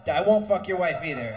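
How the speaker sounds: ordinary delivery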